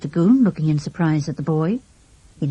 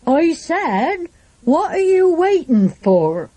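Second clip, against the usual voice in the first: mock shout; 10 semitones higher, much slower than usual. A narrator's reading. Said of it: "he raised his voice and shouted".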